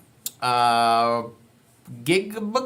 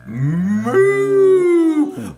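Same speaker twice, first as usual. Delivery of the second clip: higher, loud moo; a bigger, louder moo; cow moo; 5 semitones higher, 5 dB louder than usual. Pretend. Showing kids the cow sound, louder moo. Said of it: "he makes a sound like this".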